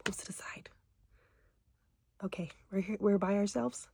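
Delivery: hushed whisper in a closet; whispering while hiding. Real, not acted.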